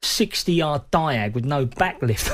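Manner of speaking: normal talking voice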